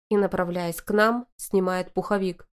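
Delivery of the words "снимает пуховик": ordinary delivery, nothing marked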